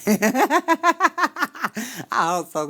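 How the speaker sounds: high, laughing hope so. Real, not acted. Laughing back at the chat, laughing.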